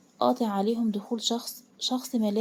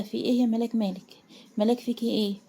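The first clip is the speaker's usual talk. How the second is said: ordinary delivery, nothing marked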